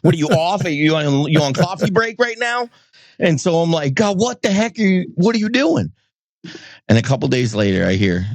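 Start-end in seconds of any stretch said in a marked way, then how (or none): 0.0-5.9 high, indignant, mock-exasperated complaint; sarcastic rant at God. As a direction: needle God like a slacking coworker. Ranting at God, mock outrage.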